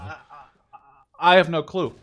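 loud laughing disbelief; giggling, still stunned. Real, not acted. Gamer laughs to friend about his streak, laughing.

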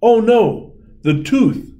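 high, loud alarm; dismayed cry. A narrator's reading. Fairy watching the tooth fly away, dismayed. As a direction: cry out as it flies off.

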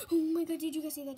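loud, fast startled outburst; real startled excitement; startled exclaim. Real, not acted. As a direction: blurt it out after the scare. After scare, to viewers.

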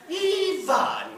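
high, loud, drawn-out name; exasperated mother. Acted. Mother addressing her son on the phone, exasperated. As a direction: call him to account with one stretched word.

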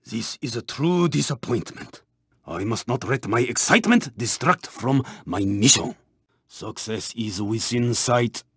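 low, soft, restrained murmur; low self-counsel. Acted. Racer steeling himself for the mission, low quiet resolve. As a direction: keep it low and quiet, to yourself.

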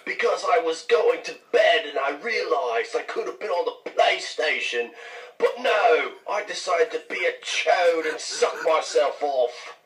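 exaggerated whiny impression of a British friend's accent; mock British accent. American mocking a British gamer in voicemails, mock British accent. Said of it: "I think I have your accent down perfectly".

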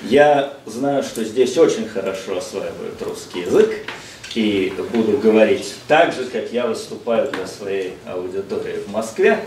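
ordinary talk, nothing marked

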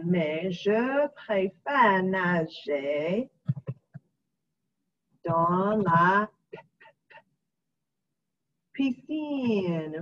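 mais je préfère nager drawn out very slowly; slow dictation. Shown as a demonstration. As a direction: spell out the phrase slowly as you type.

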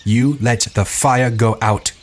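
hoarse repetition. A narrator's reading. Ralph, unmoved, blaming Jack for the fire, hoarse. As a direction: repeat it slowly, a touch hoarse.

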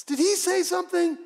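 high, sharp interruption; startled query. Acted. Soldier casting lots who overhears Jesus, startled high question. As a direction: break in, suddenly puzzled.

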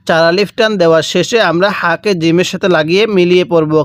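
normal talking voice

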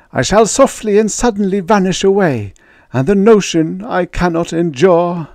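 very high, loud, fearful pleading; baker's dread. Acted. Baker insisting on repeating his fear, high and loud. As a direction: beg them to hear, full of dread.

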